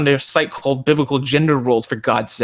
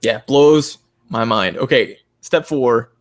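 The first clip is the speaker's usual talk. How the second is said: ordinary talk, nothing marked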